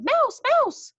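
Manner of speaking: high, loud cry of alarm. Acted. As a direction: shriek out the warning.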